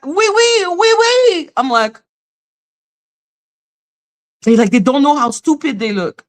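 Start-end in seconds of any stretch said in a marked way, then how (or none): 0.0-1.5 high silly parody of foreigners' French; mocking bad French. Telling them to go speak like that, high mocking imitation. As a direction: pipe it up like a clueless learner. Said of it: "Like, go speak like that".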